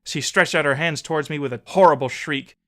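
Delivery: ordinary delivery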